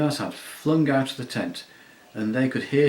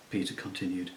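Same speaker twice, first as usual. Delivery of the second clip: ordinary delivery, nothing marked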